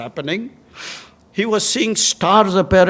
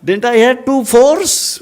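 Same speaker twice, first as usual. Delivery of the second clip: loud, high mock protest; comic boastful comeback; indignant retort; 9 semitones higher, 9 dB louder than usual. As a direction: blurt it out offended, much louder. Batsman's indignant retort acted out.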